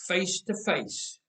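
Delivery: usual speaking voice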